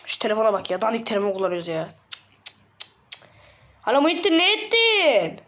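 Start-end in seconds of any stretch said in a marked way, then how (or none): 3.8-5.4 hollered phone greeting. Acted. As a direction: call out high, stretching the last words. Calling a friend on the phone.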